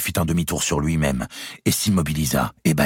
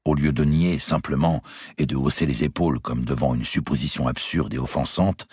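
ordinary delivery

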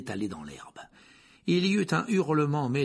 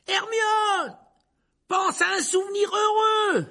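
much higher, louder, slow desperate yell; shout; 6 dB louder, slower than usual. Acted. Harry yells to Hermione as dementors close in, shouting. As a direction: yell to her over the cold. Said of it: "hurla Harry".

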